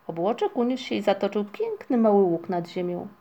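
usual speaking voice

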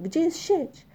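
high-pitched urgent question; urgent shout. A narrator's reading. Migotek demands the net from the crew, calling urgently. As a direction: call out, pitch jumping up. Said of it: "krzyknął Migotek".

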